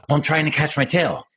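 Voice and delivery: lightly voiced excited young dog. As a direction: bounce it like an excited pup. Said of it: "He's all excited".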